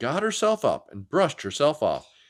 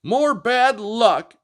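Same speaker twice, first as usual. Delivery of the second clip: high, slow, louder huff; a furious dinosaur girl; snorting grumble; 9 semitones higher, 5 dB louder, much slower than usual. Acted. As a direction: stomp out the words. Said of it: "Anda stomped round and snorted".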